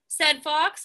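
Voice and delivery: usual speaking voice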